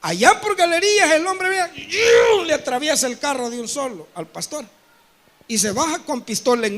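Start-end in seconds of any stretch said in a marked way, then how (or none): 1.9-2.5 loud high imitated swoosh of the car; whoosh noise. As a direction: make the car cut in with a zip. Car cutting across.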